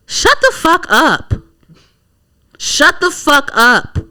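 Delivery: high, drawn-out disbelief; exasperated dismissal. Real, not acted. Answering a comedian's post, slow high exasperation.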